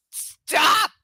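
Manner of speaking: sudden loud squeal; shriek. Real, not acted. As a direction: yelp stop, high and loud. Shrieks at being tickled.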